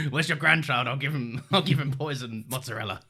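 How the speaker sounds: gruff man with a weird Scottish twang; Logan. Retold or imitated. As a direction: put on a rough Scottish-tinged patriarch voice. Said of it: "What is this Logan voice you do?".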